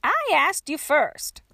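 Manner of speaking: put-on drawn-out retort from an old woman; older woman's voice. A narrator's reading. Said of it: "came a female voice".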